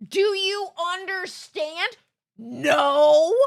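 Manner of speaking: high, loud incredulous outburst; loud disbelief. Real, not acted. Streamer answering the baffling clip, loud disbelief. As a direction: slowly echo the question, then burst out loudly.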